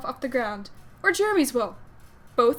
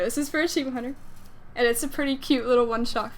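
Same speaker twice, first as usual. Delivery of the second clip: ordinary delivery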